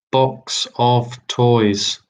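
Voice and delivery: Box of toys stretched out slowly, each word drawn; slow repeat. Shown as a demonstration. Quizmaster repeating the clue to the players, slowly drawn out. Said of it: "Question three".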